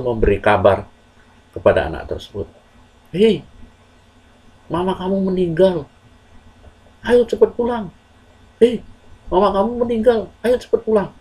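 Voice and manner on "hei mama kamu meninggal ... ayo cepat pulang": high, urgent messenger's call; calling out urgently. Acted. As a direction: shout the news across to the boy. Messenger calls boy home. (memanggil dengan nada tinggi dan mendesak)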